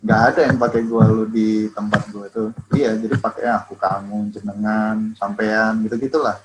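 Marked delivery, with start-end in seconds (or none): none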